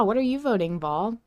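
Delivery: ordinary talk, nothing marked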